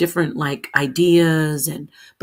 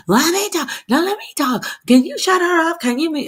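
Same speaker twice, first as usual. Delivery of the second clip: mock squabbling hen; 11 semitones higher than usual. Imitating the panel women talking over each other. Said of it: "But we were like".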